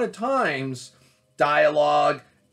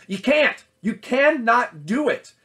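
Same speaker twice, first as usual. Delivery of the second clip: higher, emphatic "You can't", then drawn-out "cannot"; emphatic insistence; 7 semitones higher, 4 dB louder than usual. Real, not acted. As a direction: hammer home "can't" and "cannot", pitched high. Emphatic answer to own question.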